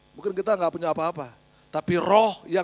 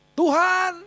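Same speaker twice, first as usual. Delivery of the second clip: high, raised cry to heaven; calling out in prayer; 12 semitones higher, 5 dB louder than usual. Retold or imitated. Praying over the table in the field, calling out loudly. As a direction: call out to God, high and loud. Saya angkat tangan.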